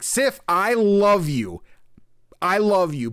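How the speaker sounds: loud, high, drawn-out declaration; imitated love confession. Character retelling his confession to Sif, loud and lovestruck. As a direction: belt out a mock lovestruck confession.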